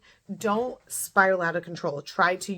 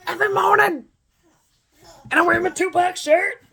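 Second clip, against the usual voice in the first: much higher, loud playful voice; playful squeal; 11 semitones higher, 7 dB louder than usual. Real, not acted. Vlogger joking about her morning pep talk, high playful squeal.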